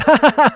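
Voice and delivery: loud, high mocking cackle; imitated laughing. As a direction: burst of jeering laughter, put on. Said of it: "he just starts laughing".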